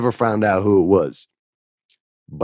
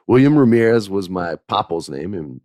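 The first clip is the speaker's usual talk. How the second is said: usual speaking voice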